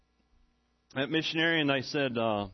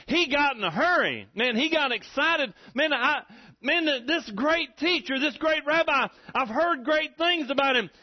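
higher and louder, rising with excitement; excited preaching; 9 semitones higher, 3 dB louder than usual. Real, not acted. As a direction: lift the congregation, get fired up. Preacher rouses the crowd.

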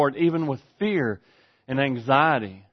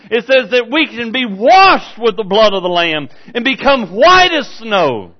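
loud, raised, emphatic pulpit delivery; rousing preacher's cry; full-force preaching; 9 semitones higher, 10 dB louder than usual. Real, not acted. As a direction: preach it with mounting fervor, stretching key words.

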